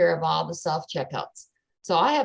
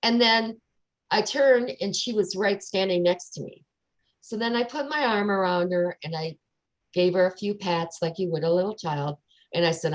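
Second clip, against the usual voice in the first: normal talking voice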